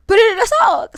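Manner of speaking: high, loud shouted command; urgent yell. Retold or imitated. Her spirit warning her to hide money, urgent yell.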